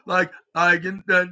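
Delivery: normal talking voice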